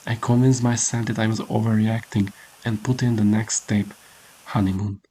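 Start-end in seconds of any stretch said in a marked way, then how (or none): none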